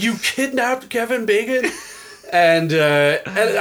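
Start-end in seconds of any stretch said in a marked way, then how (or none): 0.0-1.7 mock outraged Peter. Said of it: "And then he's like".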